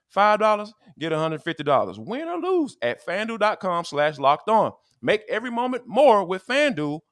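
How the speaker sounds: high, pushy sponsor pitch to listeners; podcast host hyping a sponsor deal, energetic ad read; upbeat ad read. Acted. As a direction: sell the betting offer like a radio spot.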